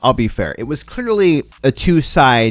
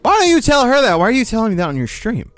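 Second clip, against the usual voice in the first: high, indignant challenge; exasperated; 9 semitones higher, faster than usual. Acted. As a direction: demand an answer, starting high. Exasperated challenge to absent streamer.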